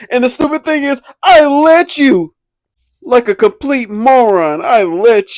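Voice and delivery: high, slow, drawn-out melodrama; milk every word like a tragic heroine; overdramatic May. Retold or imitated. Mocking May's overdramatic confession to Zuko, high and slow. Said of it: "Her over dramatic ass".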